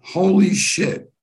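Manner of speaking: high, drawn-out "holy shit"; stunned amazement. Retold or imitated. Man reading a brochure that astounds him, stunned amazement. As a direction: let the amazement stretch each word.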